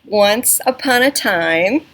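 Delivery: slow, loud storybook opening; loud slow story voice; loud clear reading voice. Shown as a demonstration. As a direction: read it out big and clear for the class. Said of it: "read the story in a loud, clear voice".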